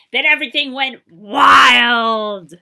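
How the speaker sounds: loud, slow, building roar; wild chaos. Acted. Narrator as the children go wild, loud and slow. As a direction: let the room explode.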